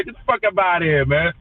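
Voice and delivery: ordinary delivery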